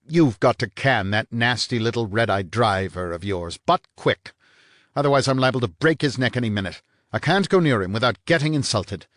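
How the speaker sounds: sharp, loud and rising on but quick; a tough guy snapping out a threat; angry snap. Acted. Vanier snaps at the blonde, angry and loud. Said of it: "snapped out".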